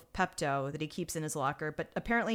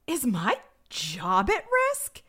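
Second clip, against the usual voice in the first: slow, high, louder stunned question; mock alarmed disbelief; 5 semitones higher, 5 dB louder, slower than usual. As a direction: ask it as the incredulous detective, drawn out. Stunned detective fears for job.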